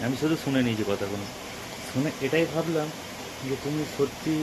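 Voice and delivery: ordinary talk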